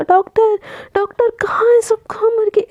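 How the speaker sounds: panicked friend screaming at empty hospital. Acted. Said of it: "एकदम चीखने चिल्लाने लगती है".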